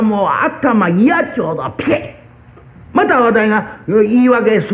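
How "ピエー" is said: slow, drawn-out breathy smack noise; smack sound. Retold or imitated. Boy imitating the sound of his mother's blows, drawn-out breathy smack. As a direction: stretch the hit into a long airy ピエー.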